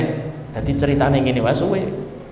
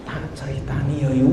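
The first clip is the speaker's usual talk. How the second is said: "Tak ceritani yo yo" stretched slow and softer, rising on "yo"; slow drawn-out telling; 4 semitones lower, 7 dB quieter than usual. Shown as a demonstration. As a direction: drag out "Tak ceritani yo yo" lazily. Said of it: "Nek intonasine alon". ("Tak ceritani yo yo" diucapkan pelan dan panjang)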